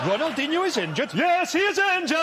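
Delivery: mock call from a minaret, rising to a shout; mosque-top call. Team sheet called from the top of a mosque, mock chanting shout. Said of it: "team sheet being read out".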